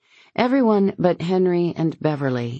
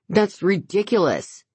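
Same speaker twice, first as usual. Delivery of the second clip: protesting; 6 semitones higher than usual. A narrator's reading. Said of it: "he protests".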